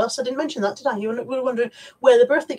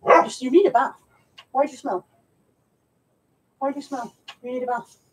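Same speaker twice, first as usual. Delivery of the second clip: teasing dog. Real, not acted.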